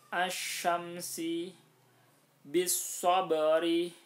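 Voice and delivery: very slow, loud Arabic recitation with the lam assimilated into the doubled letter. Shown as a demonstration. Teacher demonstrating shamsiyah examples, slow and loud. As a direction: recite each word slowly, merging al- into the next letter.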